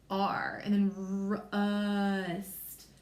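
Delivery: slow, sounding out rust sound by sound; stretched-out rust. Shown as a demonstration. Teacher sounding out a word for children, slowly stretched. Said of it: "I know it's gonna start with that".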